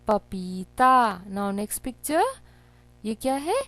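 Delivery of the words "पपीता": पपीता slowly, पी and ता held long; sounded out. Shown as a demonstration. Teacher blending syllables for children, पपीता slow and drawn out. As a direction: stretch the vowels of पपीता. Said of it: "पा में ई की मात्रा पी".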